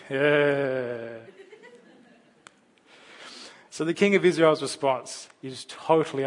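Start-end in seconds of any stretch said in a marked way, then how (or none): 0.1-1.2 a laughing knowing yeah; laughing agreement. Retold or imitated. Fellow parents agreeing with a laugh. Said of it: "you'll also be going".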